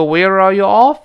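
"where are you off" climbing all the way, loud on OFF; steady rise. Shown as a demonstration. Teacher shows the question's tune, steadily rising. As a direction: push the pitch up and up to OFF. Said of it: "一直往上升".

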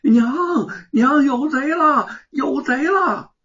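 high, loud and slow alarm call; calling for help. A narrator's reading. Boy calling his mother about the thieves, loud slow shout. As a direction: drag the shout out to wake the house. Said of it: "凤毛趁机大喊".

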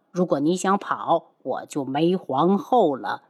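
low, tender man's voice. Acted. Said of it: "云木温柔地看着他".

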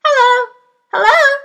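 parrot calling. Acted.

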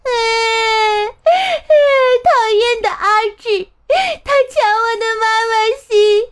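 put-on high, fast whiny wail of a little boy; crying child complaint. 小苹果 crying to his mother about 阿志, high fast tearful whine. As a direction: complain through tears like a wronged kid. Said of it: "一边哭着说".

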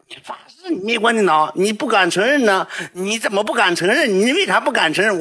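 high, pressing challenge; mock girl challenging. Girl pressing the boy to admit it, high and accusing.

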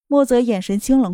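ordinary delivery

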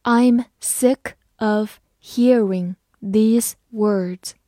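measured, separated words for learners; slow reading. Shown as a demonstration. Teacher reading an example sentence to learners, slowly word by word. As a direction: say each word on its own, slowly. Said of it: "好，慢慢来读".

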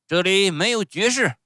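loud, high-pitched ogre bark; stern showman. Acted. Showman refuses flattering title, stern shout. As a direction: shout the plea down.

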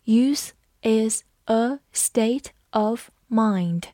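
slow, every word spaced out; slow model for repeating; slow reading. Shown as a demonstration. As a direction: read it slowly for the class to repeat. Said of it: "跟着我慢读一遍".

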